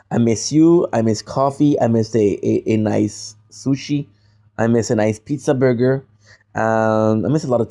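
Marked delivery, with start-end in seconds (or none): none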